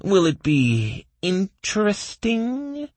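very high, slow elf voice; childlike, stretched-out hopeful question. A narrator's reading. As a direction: ask longingly in a high, drawn-out voice. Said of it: "said the bald elf with hope".

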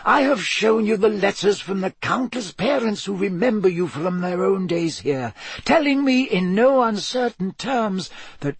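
put-on lightly amused elderly wizard; Dumbledore voice. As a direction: play the wise old headmaster, gently teasing.

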